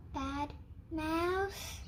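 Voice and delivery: usual speaking voice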